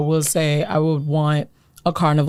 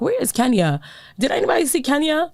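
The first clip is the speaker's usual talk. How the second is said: lightly voiced Nana searching; 6 semitones higher than usual. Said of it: "She's like".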